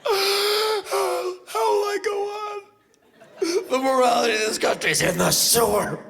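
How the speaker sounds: high, wailing hammed-up despair; over-the-top weeping lament; mock sobbing mother. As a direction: wail like a hysterical mother. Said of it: "she's in tears".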